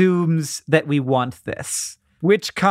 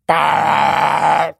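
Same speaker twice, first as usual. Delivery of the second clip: a short, louder "Bah!" like whipped cream shooting out; mock spray burst; 4 dB louder than usual. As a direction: blurt a spray noise. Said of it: "I'd just be like".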